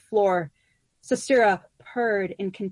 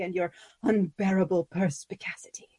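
whisper; 3 semitones lower, 3 dB quieter than usual. Acted. Imagined flattering reply.

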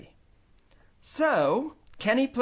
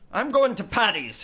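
louder angry yell; 7 semitones higher, 5 dB louder than usual. Acted. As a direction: bellow it on the way out. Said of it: "his father yelled".

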